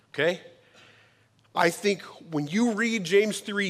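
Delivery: normal talking voice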